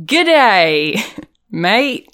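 over-the-top Australian tourist-ad voice; mock Australian accent. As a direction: play a cartoon Australian, loud and bright. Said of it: "That's pretty good".

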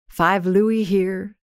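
usual speaking voice

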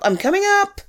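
high call up the stairs. Real, not acted. Calling upstairs to a friend, pitched high. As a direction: call it up to the room above.

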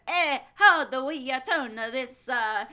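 lightly put-on Roman accent; a hint of a Roman accent, halting. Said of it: "That's my Roman accent".

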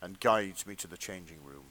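low, measured story-reading voice; hushed erotica narrator; fanfic narration. Acted. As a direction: read it like a sensual audiobook, played straight.

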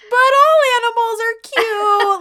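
put-on very high, loud drunken whine; hammed-up tearful protest; mock wail. Drunk woman defends every animal, wailing in mock distress.

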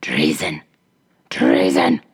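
hoarse whispering ogress cry. A narrator's reading. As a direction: cry treason like a raging witch. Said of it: "the ogress cried out".

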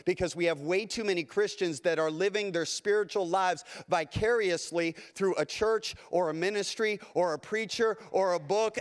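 ordinary talk

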